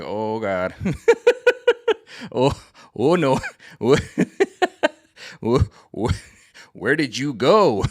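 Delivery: cracking up while quoting himself; laughing. Real, not acted. Laughing at his mother's talk of the next level.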